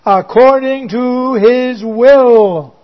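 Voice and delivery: slow, weighty according to His will; drawn-out "according to His will"; emphasized phrase. Shown as a demonstration. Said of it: "now watch out for this next phrase".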